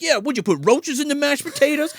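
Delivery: put-on high whiny defiant kid; high angry teen. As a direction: snap back like a sulky son. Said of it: "He's like".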